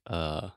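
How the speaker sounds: a relaxed low "Uh." with the mouth loose; English schwa filler. Shown as a demonstration. Teacher showing the English thinking sound, relaxed and low. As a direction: drop into a soft, low "Uh.". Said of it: "we say".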